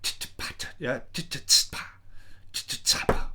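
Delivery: breathy unvoiced drum syllables; whispered beatbox. Shown as a demonstration. Producer whispering the drum rhythm to the viewer. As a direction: spit the drum pattern under the breath. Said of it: "like that".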